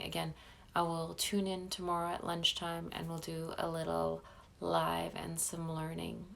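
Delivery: ordinary delivery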